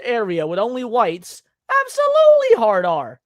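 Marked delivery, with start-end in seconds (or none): 1.8-3.2 absolutely hard R shouted high and loud; loud emphatic. Real, not acted. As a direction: belt it out, much higher and louder. Repeats claim, raised emphatic.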